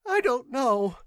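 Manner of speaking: high, loud, panicky denial; frightened clerk's shrill voice; shrill with nerves. A narrator's reading. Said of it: "shrill with taut nerves".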